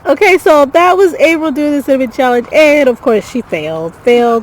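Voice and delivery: excited. Real, not acted. Announcing friend failed challenge.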